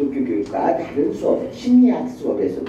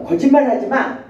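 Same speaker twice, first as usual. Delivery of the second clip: high, louder mock scolding; mother scolding; 7 semitones higher, 3 dB louder than usual. Teacher quoting mother's rebuke, mock scolding. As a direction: repeat the mother's rebuke with a bit more bite. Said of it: "엄마가".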